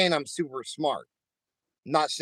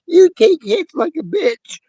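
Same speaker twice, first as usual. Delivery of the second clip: mocking high voice; 14 semitones higher, 9 dB louder than usual. Teasing in put-on voice.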